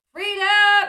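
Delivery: loud, high mock battle cry; playful full-voiced shout; joking yell. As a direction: yell it out as a joke. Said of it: "[name] jokingly yells".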